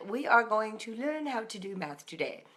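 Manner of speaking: badly faked educated British voice; educated British accent. Mom reading math aloud to kids, educated British accent. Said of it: "in my educated British voice".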